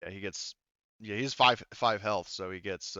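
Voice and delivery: ordinary talk, nothing marked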